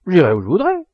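loud, high defiant retort; growled defiance. A narrator's reading. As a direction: snap back like a cornered youth. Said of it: "gronda Césario".

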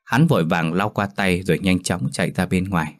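plain reading voice

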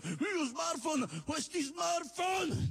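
pompous dictator roaring; outraged shout. Acted. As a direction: hammer out each word in disgust. Mocking outraged Mussolini.

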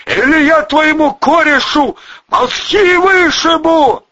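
high, loud full-throated threat; yelled threat. A narrator's reading. Volyna threatens to shoot gunman's pal, yelling. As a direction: keep yelling, push the threat hard. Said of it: "завопил Волына во всё горло".

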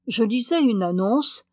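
plain reading voice

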